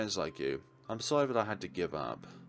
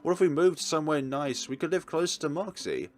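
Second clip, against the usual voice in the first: excited; 7 semitones higher, 7 dB louder than usual. Acted. Eagerly proposing moving away together.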